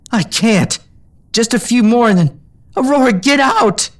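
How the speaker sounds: high, frantic pleading; desperate. A narrator's reading. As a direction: push her to get out, frantic. Frustrated, urging her away.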